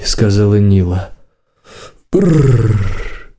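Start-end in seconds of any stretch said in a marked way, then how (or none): 2.1-3.3 long trilled shiver sound; acted shudder on rolled lips; shivering brr. A narrator's reading. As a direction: trill a drawn-out brr from the cold. Shivers at his cold body.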